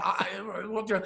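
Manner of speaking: quiet, halting stammer; imitating being speechless. Friend re-enacting his stunned reaction, speechless. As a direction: fumble for words, voice dropped. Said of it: "I was speechless".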